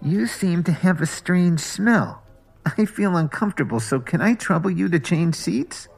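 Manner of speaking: muffled voice. A narrator's reading. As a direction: talk through a covered nose. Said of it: "said in a muffled voice".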